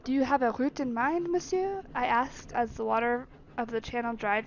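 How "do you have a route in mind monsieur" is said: put-on French accent. Servant asking master for the route, French accent. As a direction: ask politely in a French accent. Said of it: "French accent is so much easier".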